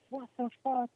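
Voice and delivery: retold freak-out. Everyone reacting to the abandoned baby, imitated freak-out. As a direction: imitate a startled crowd without raising volume. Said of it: "everyone freaked out".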